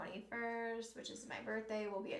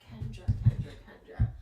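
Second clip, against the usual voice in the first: whispered summoning; 10 dB louder than usual. Acted. Summoning the sitter's energy.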